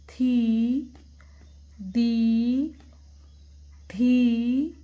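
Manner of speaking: थी and दी loud, each ee drawn out; reading-drill demonstration. Shown as a demonstration. Teacher reads back to children, long loud syllables. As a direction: chant stretched थी, दी with gaps between. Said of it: "फिर से read करते हैं".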